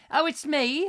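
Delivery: high, gushing caller voice; imitating caller. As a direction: coo it like an overfriendly fan. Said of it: "And I go".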